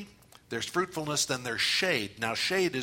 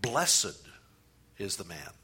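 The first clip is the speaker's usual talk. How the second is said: "Blessed" set apart with a pause after it; stress on Blessed; 4 semitones lower than usual. Shown as a demonstration. Preacher demonstrates meditating word by word, stress on "Blessed". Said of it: "just emphasize the word as you go".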